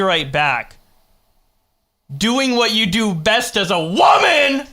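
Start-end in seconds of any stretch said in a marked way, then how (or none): 2.1-4.7 high, loud jeering shout; mock sexist shout. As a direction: yell it like a crude heckler. Reading sexist joke aloud.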